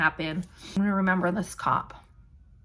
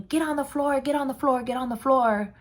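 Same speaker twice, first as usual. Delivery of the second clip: higher-pitched commands, repeated like a barked order; retold police yelling; 6 semitones higher than usual. Police shouting at the people inside, high and urgent. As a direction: echo the cops ordering her onto the floor. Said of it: "they started yelling".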